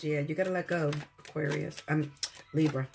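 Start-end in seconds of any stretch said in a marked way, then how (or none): none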